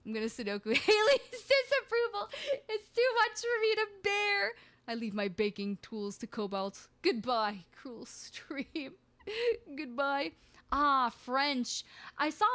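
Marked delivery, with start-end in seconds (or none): 0.8-4.5 high-pitched, laughing mock despair; mock anguish. Mock despair at being booed. 7.0-10.3 mock tragic farewell. Mock farewell before fake suicide joke.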